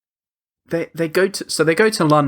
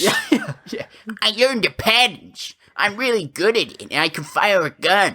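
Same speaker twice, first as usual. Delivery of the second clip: mocking smug gamer; 8 semitones higher than usual. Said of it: "'Cause he's like".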